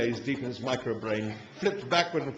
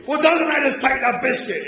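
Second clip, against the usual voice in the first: much higher, loud furious shout; furious roar; 12 semitones higher, 8 dB louder than usual. A narrator's reading. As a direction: rage at the insult. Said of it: "it roared".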